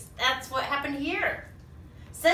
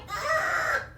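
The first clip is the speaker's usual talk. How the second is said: monster scream; 14 semitones higher than usual. Acted. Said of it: "He's going".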